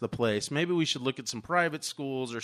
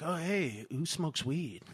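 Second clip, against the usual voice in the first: put-on softer, casual feeler to the other kids; sly asking; 8 dB quieter, slower than usual. New kid sounding out his classmates, sly and soft. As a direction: toss it out quietly, like a new kid.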